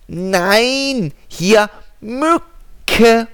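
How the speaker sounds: high, loud squeaky mosquito; tiny mosquito voice. Acted. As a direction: chirp it like a tiny insect. Mosquito answers.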